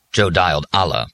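ordinary delivery

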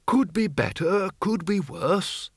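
high, drawn-out character voice; Windle. A narrator's reading. Battered Windle answers comrade.

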